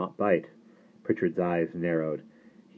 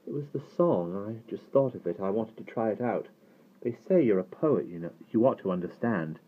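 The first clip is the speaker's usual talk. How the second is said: lightly voiced foolish boy, higher pitch; foolish act; 5 semitones higher than usual. A narrator's reading. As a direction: play the innocent, eager to explain. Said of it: "expression of total foolishness".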